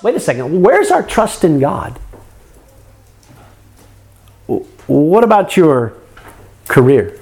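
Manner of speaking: ordinary delivery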